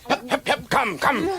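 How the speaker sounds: very high, repeated urging calls; animal driving call. Acted. Rider urging the camel forward, high calls. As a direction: call the beast on sharply.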